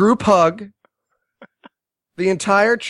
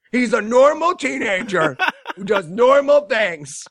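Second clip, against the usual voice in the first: higher, laughing over-insistence; mock insistence; 4 semitones higher than usual. Film insisting the kid is fine, mock emphatic reassurance. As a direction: insist on it too hard, amused.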